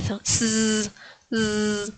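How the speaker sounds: sustained soft buzzing "z"; vibrating Z. Shown as a demonstration. Teacher isolating the Polish sound, long buzz. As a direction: hold z as a voiced buzz, no vowel. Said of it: "como una Z vibrante".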